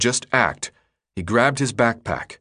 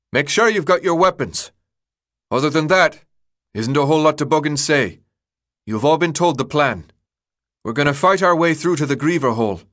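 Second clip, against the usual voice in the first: higher, raised voice briefing the troops; Newt, rallying leader; 5 semitones higher, 4 dB louder than usual. A narrator's reading. Newt briefs the Gladers before the fight, raised rallying voice. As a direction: address the whole group as their brisk commander.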